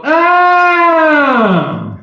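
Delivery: long, loud high "ohh"; drawn-out whoop falling in pitch; mocking "ohh". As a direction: howl it like a crowd after a burn. Crowd-style reaction to stepmom's jab.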